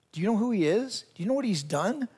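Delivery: hushed, rising gossipy questions; mock a scandalized aside, lifting each question at the end; imitating gossiping onlookers. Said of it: "people who knew me from before would be like".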